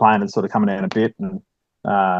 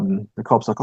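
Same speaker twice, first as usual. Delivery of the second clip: usual speaking voice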